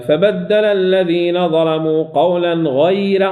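slow chanted verse, ra of غير thin; Quranic recitation. Shown as a demonstration. Teacher recites verse for the ra after ya. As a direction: recite it slowly keeping the ra of غير light.

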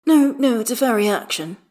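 high, loud "no, no", then easing; mock protest. A narrator's reading. Astarion defends the shy child, lightly protesting. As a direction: object playfully, starting high. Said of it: "Astarion protests".

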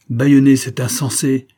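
commanding outburst; imperious. A narrator's reading. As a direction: cut in with an imperious order. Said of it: "interrompit une voix impérieuse".

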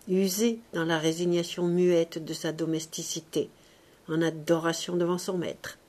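plain reading voice

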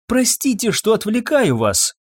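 high, pleading apology; apologetic doctor. A narrator's reading. Doctor apologises for distracting a busy woman, apologetic. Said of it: "повторил доктор".

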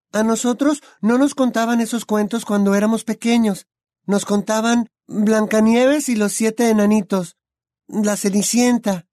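put-on high, bright young witch voice; Hermione's voice. A narrator's reading. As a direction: voice the clever girl friend.

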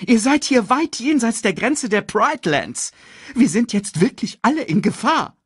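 high, agitated voice; urgent warning. Acted. Sasu warns the lions beyond the border, agitated.

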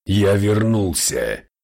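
slow, loud demon-lord proclamation; demonic rumble. Acted. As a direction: draw it out like a returning demon. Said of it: "пророкотал тот".

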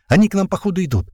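high, urgent warning; almost yelling. A narrator's reading. Sliva warns the team of attackers, almost yelling. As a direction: raise the alarm, nearly yelling. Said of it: "почти орёт Слива".

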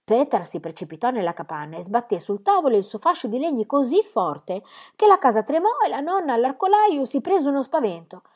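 ordinary delivery, nothing marked

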